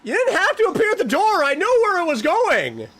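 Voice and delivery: high, loud, indignant yelling; shrill-pitched protest at a jump scare; exasperated shouting. Real, not acted. Yells at apparition at the door.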